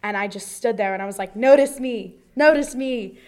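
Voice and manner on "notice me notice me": loud, mock-desperate call for attention; increasingly aggressive plea. [name] begging her crush to notice her, aggressive loud plea. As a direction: call it out, higher and louder, begging to be seen. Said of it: "they're getting more aggressive".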